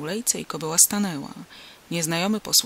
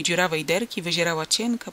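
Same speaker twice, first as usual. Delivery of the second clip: ordinary delivery, nothing marked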